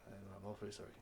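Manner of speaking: soft, halting bad news; apologetic guard. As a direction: stammer an apology to the prince. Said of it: "The guard comes back and goes".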